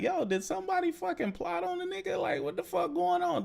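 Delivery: high, soft retold shock; retelling shock at the huge water bill; mock disbelief. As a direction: sound baffled and suspicious. Retelling his shocked reaction.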